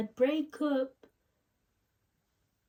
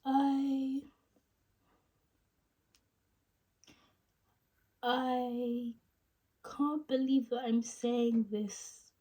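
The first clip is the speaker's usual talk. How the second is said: halting, higher tearful break-up confession; Rachel; 4 semitones higher than usual. Acted. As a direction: ache through long pauses, voice pitched up near tears. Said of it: "sad tone".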